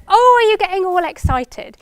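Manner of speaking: a lightly voiced excited mother; imitating mum's cooing. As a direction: echo the mum's delighted question. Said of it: "and she said".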